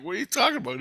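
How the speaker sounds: high-pitched mock disbelief; retold bafflement. Acts out his baffled question.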